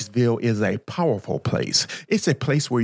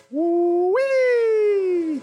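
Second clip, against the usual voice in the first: excited whoop. Real, not acted.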